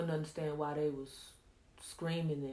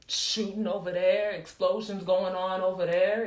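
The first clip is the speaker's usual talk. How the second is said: loud, urgent retelling of gunfire all round; louder, insistent listing of dangers; loud battle report; 8 dB louder than usual. Acted. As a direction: raise your voice over the chaos of battle. Acting out the battle he saw.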